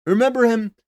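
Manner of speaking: high, loud hail to the brothers; a loud, high-pitched opener to the crowd; rallying call. Real, not acted. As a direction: call the audience in like a host. Rallying viewers, opening new topic.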